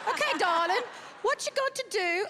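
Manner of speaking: a light, badly faked British accent. Mocking an English judge in a bad British accent. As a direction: put on a shaky stab at English posh, darling. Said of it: "I know that was bad accent".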